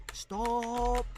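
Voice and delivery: high, drawn-out cry; yell. A narrator's reading. Hiccup as feathers fly everywhere, high alarmed yell. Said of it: "yelled Hiccup".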